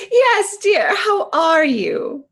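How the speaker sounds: a hint of a proper lady; prim lady voice. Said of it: "straighten up and say".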